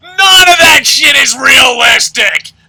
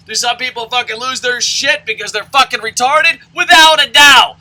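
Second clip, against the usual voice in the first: ordinary talk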